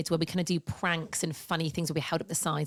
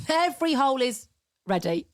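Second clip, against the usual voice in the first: mock proclamation; 6 semitones higher than usual. Cohost mocks the misheard phrase, in a hammed-up voice. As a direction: announce it grandly as a joke. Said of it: "Imagine going around saying".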